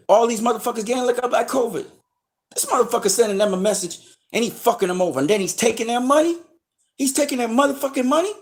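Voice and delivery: working himself up, pitch soaring with each line; rising outrage. Real, not acted.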